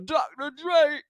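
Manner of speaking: high, put-on rapper voice. Host doing a rapper impression, pitched high.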